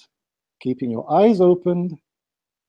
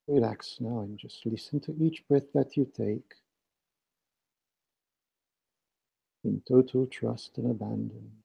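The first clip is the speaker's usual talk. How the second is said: right in your ear; 6 semitones lower, 4 dB quieter than usual. Acted. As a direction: guide them inward, hushed and close. Leads guided meditation with breathing.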